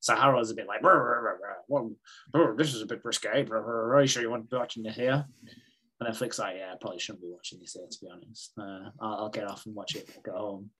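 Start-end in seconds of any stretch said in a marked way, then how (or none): 0.8-5.2 put-on flustered old man. Harold flustered by racy film. 6.6-10.7 sheepish and quiet. Retold or imitated. Flick embarrassed, excusing herself.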